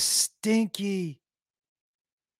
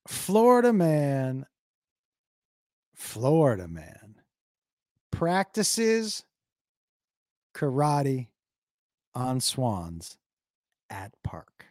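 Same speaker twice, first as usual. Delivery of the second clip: slow, drawn-out headline announcement; reading a headline like a newsreader, savouring it; dramatic headline read; much slower than usual. Shown as a demonstration. Reads his favourite Florida man headline to chat, slow and dramatic. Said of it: "my favorite Florida man headline is".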